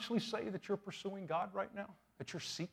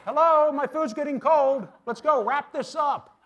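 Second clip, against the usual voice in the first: much higher, much louder, exasperated; impatient mock complaint; 10 semitones higher, 13 dB louder than usual. Pastor mocking a long-winded grace at a meal, loud and impatient. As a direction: blurt it out like a starving diner fed up with a long prayer. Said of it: "I'm going".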